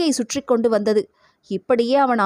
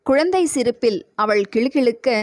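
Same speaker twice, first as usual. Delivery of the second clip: usual speaking voice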